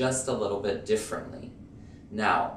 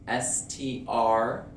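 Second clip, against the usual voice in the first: usual speaking voice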